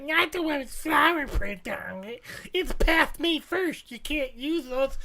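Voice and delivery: slow, drawn-out mother-in-law impression; scolding mother-in-law. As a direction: fuss slowly like a disapproving mother-in-law. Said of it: "I got yelled at by my mother-in-law".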